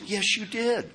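high-pitched insisting comeback; insistent retort. Retold or imitated. Woman contradicting him in a retold argument, high insistent retort. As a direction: imitate her contradicting you, pitched right up. Acted back-and-forth argument with her.

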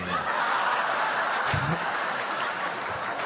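unvoiced chuckle; a breathy laugh at himself. Real, not acted. Laugh after the quote.

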